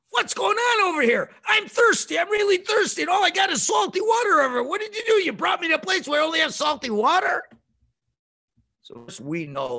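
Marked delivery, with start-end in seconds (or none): 0.1-7.4 much higher, loud, fast demanding whine; rude complaint. Shown as a demonstration. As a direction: shout it like a spoiled brat. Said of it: "Second way is".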